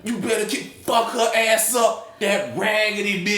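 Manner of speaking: high, loud egging-on; retold screaming. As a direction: holler at the movie screen, drawing out the words. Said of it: "I kept screaming".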